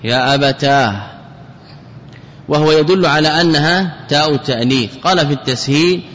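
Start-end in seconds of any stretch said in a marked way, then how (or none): 0.0-1.0 يا أبتاه with long "aa" closed by an h; final ha. Shown as a demonstration. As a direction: draw out the alif of يا أبتاه, then breathe the ه. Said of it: "ويجوز إبدال هذه التاء هاء".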